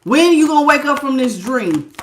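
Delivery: normal talking voice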